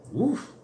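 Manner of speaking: yelping woof of amazement; high woof. Real, not acted. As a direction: let out a sharp high woof. Reacts to tough matchup.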